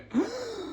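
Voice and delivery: sharp breathless gasp, wind knocked out; imitated winded gasp. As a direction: gasp like landing flat on your back. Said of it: "he went".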